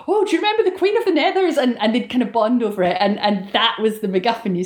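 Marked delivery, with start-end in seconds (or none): 0.1-1.6 eager fellow fan. Retold or imitated. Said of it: "someone else would come in and go".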